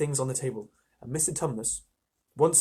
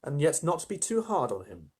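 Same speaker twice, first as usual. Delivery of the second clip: plain reading voice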